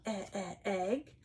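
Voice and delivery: short "E, e" sound repeated before egg; E sound demo. Shown as a demonstration. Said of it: "starts with the E sound".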